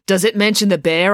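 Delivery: put-on girl spraying crumbs, mouth full of donut; talking with mouth full. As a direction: speak through a mouthful. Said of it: "mouth stuffed full of donut".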